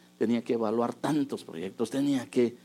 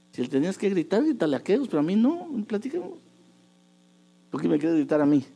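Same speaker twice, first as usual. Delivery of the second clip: high, loud plea not to be shouted at; husband begging his wife not to yell at him; raised pleading; 8 semitones higher, 4 dB louder, faster than usual. Retold or imitated. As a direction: push back, pleading, rising up.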